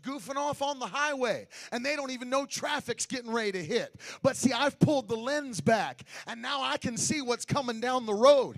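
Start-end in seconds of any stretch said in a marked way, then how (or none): 4.2-8.6 loud, rising sung preaching; full force preaching. Acted. Preacher shouting holiness at the congregation, loud and sung. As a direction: whoop it up to the congregation.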